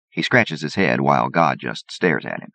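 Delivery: ordinary delivery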